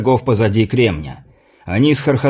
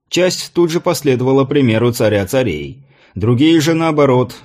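usual speaking voice

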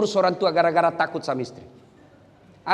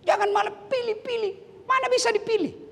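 very high, soft put-on voice; mimicked protester; 4 dB quieter than usual. Preacher mimicking those who say this, high put-on protest. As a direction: mimic the objection in falsetto.

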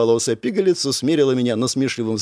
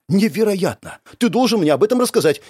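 high, eager enthusiasm; amazed; 6 semitones higher than usual. A narrator's reading. Girl demanding the lad's story, amazed. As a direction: press him eagerly, pitched up. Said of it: "вновь охнула Беляна".

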